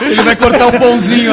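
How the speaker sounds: ordinary talk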